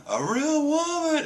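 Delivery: gasp, then very high-pitched disbelief; hammed-up high squeal of wonder; mock amazement. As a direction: imitate someone awestruck, voice way up high. Acted amazement at seeing a woman.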